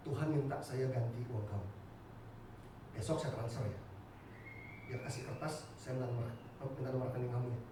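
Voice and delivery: low, hushed and earnest; solemn quiet offer. Retold or imitated. Former enemy offering repayment from God, low and subdued.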